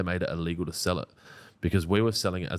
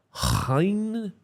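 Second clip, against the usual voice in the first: put-on German accent, pitched much higher; 12 semitones higher, 3 dB louder, much slower than usual. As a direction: say it high in a mock German accent. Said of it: "That was meant to be German".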